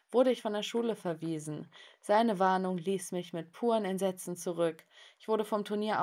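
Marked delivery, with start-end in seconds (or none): none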